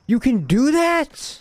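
high, loud, slow disbelief; astonished. Real, not acted. Streamer amazed at a character's hidden ability, high loud disbelief. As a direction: shoot up in pitch, amazed.

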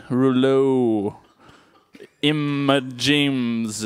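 Rouleau, Emma James stretched out very slowly; playful mispronunciation. Pretend. Host fumbling the captain's name, Rouleau, Emma James drawn out slowly. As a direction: draw out each syllable of "Rouleau, Emma James". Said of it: "is that how you say that?".

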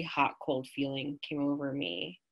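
usual speaking voice

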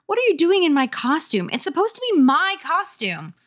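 high-pitched big-sister scolding; indignant. Acted. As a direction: accuse a brother indignantly. Acted sibling quarrel.

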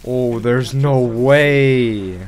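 loud, very slow incredulous groan; drawn-out disbelief. Real, not acted. Gamer reacting to the Vex's power, drawn-out disbelief. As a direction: stretch each word in amazement.

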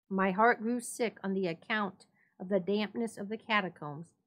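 plain reading voice

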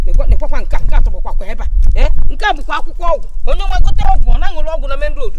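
loud, indignant complaint to the street; loud scolding rant. Acted. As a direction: hold up the rubbish and scold loudly. Ranting about litter on road.

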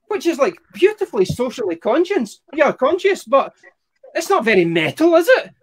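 ordinary delivery

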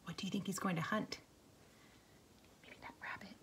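soft secret whisper. Real, not acted. Teacher whispers question to kids.

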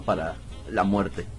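normal talking voice

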